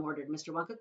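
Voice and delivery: plain reading voice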